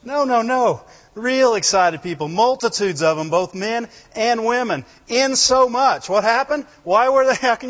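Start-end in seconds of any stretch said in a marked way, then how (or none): none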